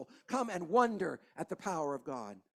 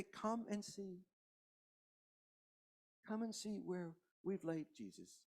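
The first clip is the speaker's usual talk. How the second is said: hushed grief; 11 dB quieter than usual. Acted. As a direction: breathe it out like mourners at a grave. Mourners quoted at tomb.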